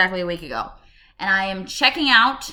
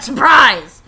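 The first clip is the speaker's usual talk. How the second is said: loud, higher burst; sudden tic shout; 6 semitones higher, 13 dB louder than usual. Real, not acted. Tic breaking into the video, sudden loud shout. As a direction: burst out with it at full volume.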